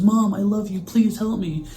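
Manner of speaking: high-pitched, urgent mock plea; desperate pleading. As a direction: imitate a boy begging his mom, high and loud. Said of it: "he sounds desperate, he's pleading".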